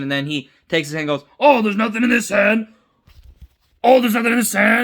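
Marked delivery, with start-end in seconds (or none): 1.4-4.8 high, loud put-on holler; loud mock taunt. Retelling a clone showing his hand, loud mock taunt. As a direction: call it out big and high, playacting.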